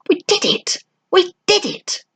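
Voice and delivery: put-on high, gleeful boyish cheer; excited cheer. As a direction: shout it out in triumph, way up high. Boys cheering after victory.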